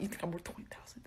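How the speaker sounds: fast, whispered scorn; contemptuous whisper. Retold or imitated. Caesar whispering his contempt to the pirates.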